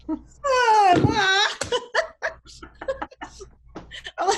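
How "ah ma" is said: high, loud whiny groan; exasperated mock whine at mother. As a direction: whine at mom like a teen. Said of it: "I'm like".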